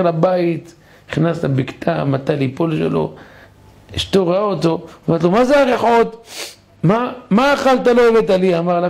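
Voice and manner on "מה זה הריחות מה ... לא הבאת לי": complaining wife. Acted. Wife complains about the smell.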